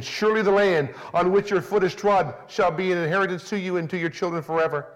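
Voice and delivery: ordinary talk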